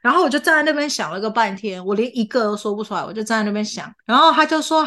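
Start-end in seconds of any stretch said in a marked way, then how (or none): none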